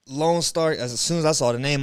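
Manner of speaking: normal talking voice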